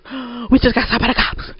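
imitated panting between words; out of breath. Friend bursting in after fleeing police, breathless. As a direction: gasp it out as if just run. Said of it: "he comes running".

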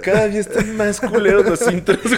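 laughing through the words; barely holding in laughter; stifled giggles. Real, not acted. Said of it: "me estaba aguantando la risa".

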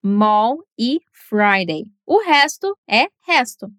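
ordinary delivery

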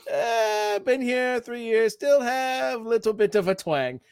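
a hint of a Thai accent, retold for laughs; Thai accent impression. Host imitating Sing's fake Thai accent line, light Thai accent. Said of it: "The twang part kills me".

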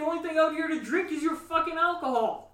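yelling a complaint, half joking; loud complaint. Real, not acted. Complaining about nothing to drink.

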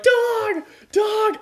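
very high panicked shriek; high frightened child's shriek; panicked screaming. Retold or imitated. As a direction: scream the word in terror. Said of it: "I was scre-, I'm like".

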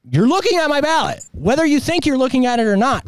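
indignant. Retold or imitated. Retold confrontation with poll worker.

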